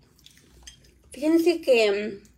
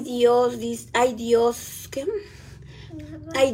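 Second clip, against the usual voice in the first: ordinary talk, nothing marked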